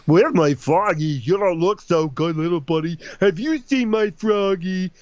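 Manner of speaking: big dopey cat, high and loud at first; stupid-sounding cartoon voice. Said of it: "a stupid sounding voice".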